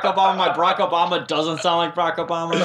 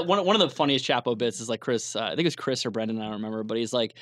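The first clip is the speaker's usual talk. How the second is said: ordinary talk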